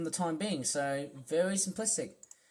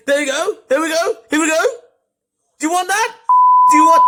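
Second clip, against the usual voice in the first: mocking quote; 12 semitones higher, 16 dB louder, faster than usual. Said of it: "you always say".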